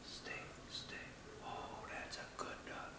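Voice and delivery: soft whisper to a settling puppy; gentle whisper. Shown as a demonstration. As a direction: whisper it gently to calm the dog. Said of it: "I'll whisper to 'em".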